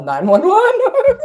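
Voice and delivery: very high, slow squeaky joke voice; mock emergency call. Teasing chat about calling the police, squeaky high mock voice. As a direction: stretch it out high and silly.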